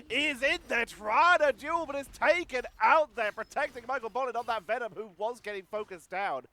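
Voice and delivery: loud, high, excited caster; live esports play-by-play. Real, not acted. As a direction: burst into urgent action calling.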